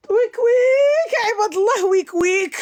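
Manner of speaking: very high, loud hammed-up wailing; fake wailing. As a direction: wail like a fake crying fit. Acted crying fit at the boss.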